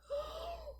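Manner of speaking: high startled gasp; a quick shocked intake of breath; sharp gasp. A narrator's reading. Narrator gasps at Gilbert's trick, a high startled gasp. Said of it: "I don't like where this is going".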